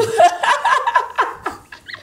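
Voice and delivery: teasing laugh. Real, not acted. Woman who just mocked the host, bursting into laughter. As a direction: burst out laughing, high and long.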